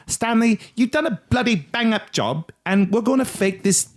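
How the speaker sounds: cheeky Australian bloke asking a favour; mock Aussie. Host voicing NASA to friends, mock Australian accent. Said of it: "nasa saw this and they were like".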